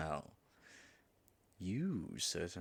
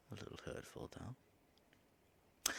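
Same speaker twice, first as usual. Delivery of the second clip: hushed, softer wounded aside; whispered aside; 13 dB quieter, slower than usual. Acted. Dragon in disguise, hurt by the insult, whispered aside. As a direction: breathe it to yourself, not meant to be heard.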